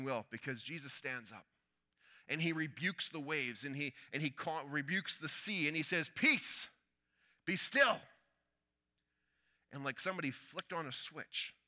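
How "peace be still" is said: much higher and louder command; commanding rebuke. Acted. Jesus commands the wind and waves, loud and high. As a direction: order the sea to be still.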